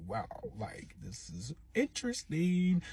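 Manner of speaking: softly drawn-out onlooker's remark; hushed start, slowly stretching into feigned interest; mock intrigued aside. Bystander to herself while they argue, slow intrigued aside.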